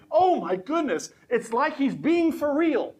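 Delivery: high, startled voice of the opera crowd; shocked audience. Retold or imitated. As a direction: gasp it out like a stunned onlooker. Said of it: "you hear the audience in the opera say".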